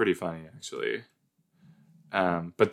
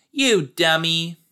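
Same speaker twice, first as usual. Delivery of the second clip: high, loud, drawn-out jeer; sing-song it slowly at the dummy; mock taunt; 9 semitones higher, 5 dB louder, slower than usual.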